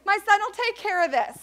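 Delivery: high, loud exasperated mom; classic mom. Acted. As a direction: scold him like a doting mother. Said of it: "she's like".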